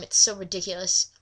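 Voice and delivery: usual speaking voice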